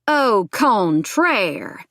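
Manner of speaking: slow, loud Southern drawl; exaggerated Southern accent. A narrator's reading. Ex-boyfriend taunts her, exaggerated Southern accent. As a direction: draw it out in an overdone Southern accent. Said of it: "in an exaggerated Southern accent".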